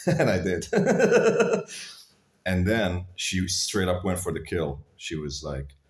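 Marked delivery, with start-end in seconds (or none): 0.7-1.9 high laugh. Real, not acted. Laughing at his story.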